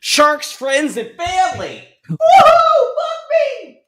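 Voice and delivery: put-on high wild drunken yell; blackout drunk shout. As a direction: scream it like a wasted partier. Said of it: "I'm really drunk right now".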